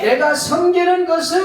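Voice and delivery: high, loud preaching voice, slowing on the gift; full-force preaching. Acted. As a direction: proclaim it to the congregation. Building sermon list. (높고 힘찬 설교)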